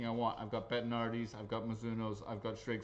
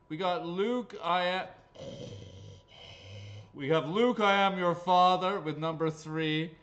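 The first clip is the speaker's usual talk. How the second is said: loud, higher, lightly hammed announcer; hammed-up movie line; 5 semitones higher, 7 dB louder than usual. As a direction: call out the dad joke with relish. Announcing Star Wars dad joke.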